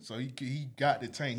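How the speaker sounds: ordinary talk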